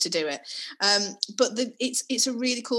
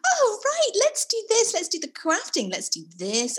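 chirpy Mary Poppins mum; 12 semitones higher than usual. Retold or imitated. Mum mimicking her keen early-lockdown self, chirpy and high. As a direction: chirp it out like a perfect homeschool mum. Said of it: "Mary Poppins homeschool mum".

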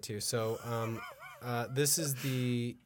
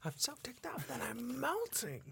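mock Iago; 13 semitones higher, 4 dB quieter than usual. Repeating the Iago line.

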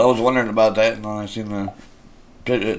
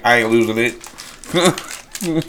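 cocky confident drawl; much slower than usual. Retold or imitated. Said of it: "he's like".

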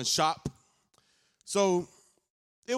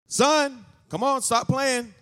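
raised call; 7 semitones higher, slower than usual. Retold or imitated. Mother calling her hiding son to leave, raised voice.

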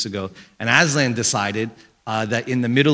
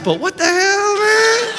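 high, loud whiny outburst; hammed-up exasperated cry; mock hurt; 5 dB louder, slower than usual. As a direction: wail it like a wounded dad. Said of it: "You know how much that hurts you?".

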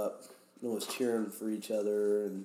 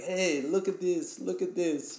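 lightly voiced eager Russian, pitched up; imitated Russian guy; 6 semitones higher than usual. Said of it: "one of the Russian guys. He's like".

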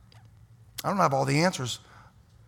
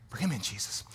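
softer, lower closing plea; hushed prayer; 6 semitones lower, 10 dB quieter than usual. Acted. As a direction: drop to a soft, low prayer. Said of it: "I'm praying them".

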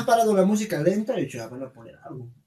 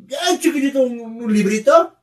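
mocking; 9 semitones higher, 8 dB louder than usual. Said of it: "mocking the small menu".

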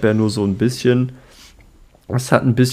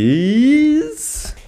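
drawn-out high anyways; much slower than usual. Real, not acted. Laughing, drawn-out change of topic.

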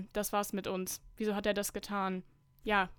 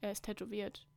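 usual speaking voice

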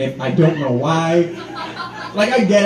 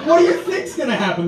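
normal talking voice

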